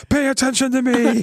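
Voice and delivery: high, demanding pretend yell; mock yell. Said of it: "I'm gonna yell at them".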